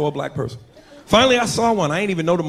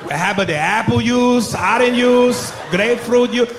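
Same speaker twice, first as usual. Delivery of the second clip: mock Mexican accent; 6 semitones higher, 3 dB louder, slower than usual. Cashier listing juices.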